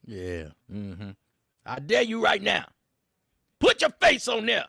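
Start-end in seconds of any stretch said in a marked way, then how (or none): 1.6-4.6 higher and louder taunt; loud dare. Real, not acted. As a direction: throw the challenge hard. Repeating his dare to gamers.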